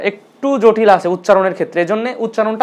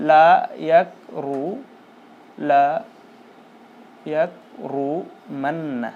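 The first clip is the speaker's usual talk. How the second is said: very slow broken demo; 5 semitones lower, 7 dB quieter, much slower than usual. Shown as a demonstration. Teacher spelling the word out slowly to students. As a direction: split into pieces, very slowly. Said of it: "মূলত এভাবে হবে".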